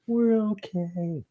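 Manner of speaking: very high, drawn-out falsetto; high silly voice. Pretend. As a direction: squeak it out slowly, way up high. Repeats line in silly high voice.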